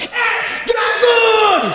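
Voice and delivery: hammed-up yell out the window; mock yell at driver. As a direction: shout it at the car ahead. Yelling at driver in car.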